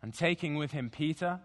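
slow, low, measured scripture reading; slow deliberate reading. Shown as a demonstration. Pastor reading Gethsemane to the congregation, slow and deliberate. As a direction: read each phrase slowly, letting it settle. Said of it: "read the passage again, again, slowly, deliberately".

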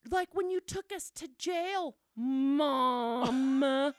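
put-on high whining child, long loud MOM; mock-tearful complaint, stretching out the last word; accusing daughter. Daughter reminds mom of jail, whiny accusation. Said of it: "She's still very traumatized".

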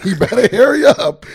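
loud, high, through laughter; real laughing. Real, not acted. Comic repeating his joke to his friend, cracking up. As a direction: crack up while saying it.

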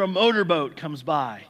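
ordinary talk

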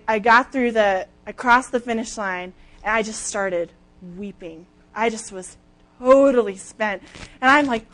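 ordinary talk

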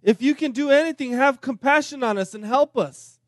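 a father begging, pitched high and loud; desperate plea. Retold or imitated. Father begging Jesus to heal his son, loud desperate plea. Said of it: "look at the boy's, the father's response".

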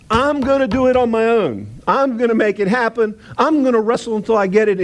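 mock the man doing it on his own; lightly voiced Jacob boasting he'll manage alone; stubborn Jacob. Jacob voiced, self-reliant.

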